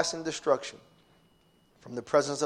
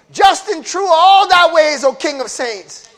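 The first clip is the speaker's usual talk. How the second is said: slow, high, loud praise; shouted praise; 13 semitones higher, 13 dB louder, slower than usual. Acted. Preacher exalting God, slow and loud. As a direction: cry it out to the King.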